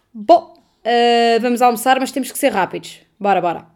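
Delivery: lightly voiced brisk doctor, starting loud; doctor to colleague. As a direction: imitate a doctor casually announcing lunch. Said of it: "vira-se para o outro médico e diz". (médico despachado, ligeiramente imitado)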